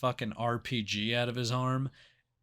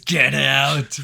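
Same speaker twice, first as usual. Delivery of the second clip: much louder get out; mock shout; 9 dB louder than usual. Imitating the cop ordering the kid out, a loud shout. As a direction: bark it at the kid. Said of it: "He goes".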